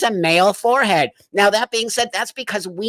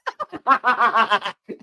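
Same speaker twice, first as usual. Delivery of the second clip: helpless burst of laughing; amused laughing fit; real laughter; 3 semitones higher than usual. Real, not acted. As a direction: crack up mid-thought. Cracking up at the played clip.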